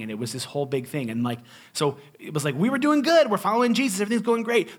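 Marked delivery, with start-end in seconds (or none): 2.5-4.8 mock cheerful. Mock cheerful life before tragedy.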